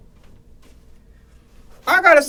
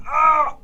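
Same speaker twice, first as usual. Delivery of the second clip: high yell of pain; 8 semitones higher than usual. Real, not acted. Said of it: "I'm yelling like [name]".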